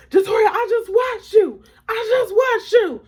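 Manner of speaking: very high, loud, stretched insistence; high-pitched motherly shout; mama yelling. Retold or imitated. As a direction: imitate mom shouting an accusation, hammering each word. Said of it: "she's down hard yelling at me".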